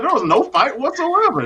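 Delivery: ordinary delivery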